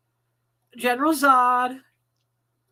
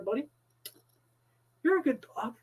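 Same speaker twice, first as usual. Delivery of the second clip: usual speaking voice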